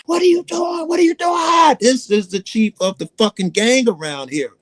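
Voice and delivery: imitating a panicked scream. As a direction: shriek it like he's been set on fire. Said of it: "He started screaming like he was on fire".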